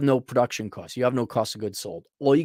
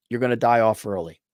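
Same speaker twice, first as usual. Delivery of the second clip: ordinary delivery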